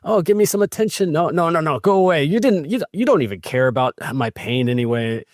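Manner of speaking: high, whiny demanding then rejecting; mock a cranky kid wanting attention; hammed-up whiny fuss; fussy child. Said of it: "like you just described".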